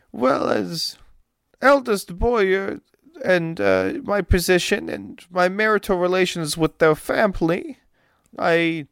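high, dramatic voice with broken English; fretful noblewoman. Acted. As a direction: wring your hands over the poisoning. GM voices Olga.

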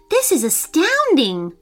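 high-pitched, mildly awestruck exclamation; amazed disbelief. A narrator's reading. Chloe gasps at the scene before her, mildly awed disbelief. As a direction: marvel at it, pitch lifted. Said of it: "Chloe sighed in disbelief".

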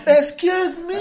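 high-pitched, laughing mock-offended squeal; mock indignation. Comedian acting out their offended reply at the cinema, mock indignant. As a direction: act outraged, voice leaping way up.

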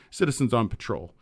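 ordinary delivery

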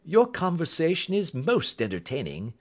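high, airy, amused politeness. Acted. Holmes answers Roylott's insults, amused. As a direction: say it lightly, pitched well up. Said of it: "Holmes chuckled heartily".

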